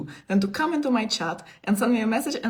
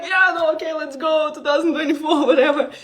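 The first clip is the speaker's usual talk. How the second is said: high, loud, laughing bravado; laughing defiance; 8 semitones higher, 7 dB louder than usual. Retold or imitated. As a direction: laugh it off, daring the year. Retelling her defiant reaction, laughing.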